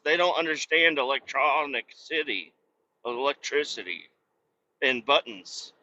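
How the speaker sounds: usual speaking voice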